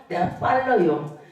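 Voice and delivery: ordinary talk, nothing marked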